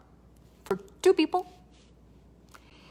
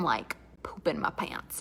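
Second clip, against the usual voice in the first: scared whisper; 6 semitones lower, 9 dB quieter than usual. Real, not acted. Confessing fear.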